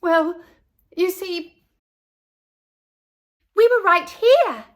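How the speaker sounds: put-on high, loud child's squeal. A narrator's reading. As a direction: squeal it out in delight. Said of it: "Ernestine squealed".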